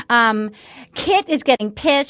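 ordinary talk, nothing marked

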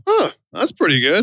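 a casually pleased huh; pleased surprise. Retold or imitated. Opening mailbox residual money, lightly mock-impressed. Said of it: "you go".

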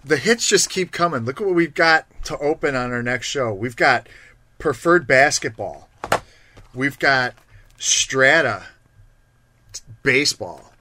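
punchy show promo with pauses before the reveals; excited hype. Real, not acted. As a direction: announce each prize like a big reveal. Hyping next show's products.

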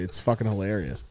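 normal talking voice

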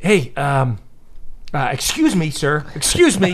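lightly put-on polite dad voice; hesitant, contained dad getting someone's attention. Dad stopping a reckless snowboarder, contained dad voice. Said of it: "Dad voice".

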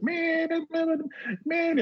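squeaky mock angry protest; imitating Carl's high voice; high-pitched yelling. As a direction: repeat it in a very high strained voice. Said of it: "yelling in this high-pitched voice".

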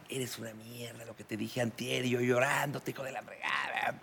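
soft, menacing insult. Retold or imitated. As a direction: lay into the traitor under your breath.

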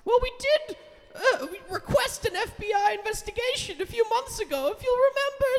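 high character voice. Acted. Improv character speaking to Jeff.

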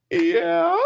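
put-on tiny child voice. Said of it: "you can hear the mom going".

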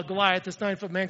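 ordinary talk, nothing marked

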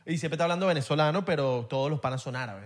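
usual speaking voice

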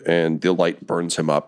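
ordinary delivery

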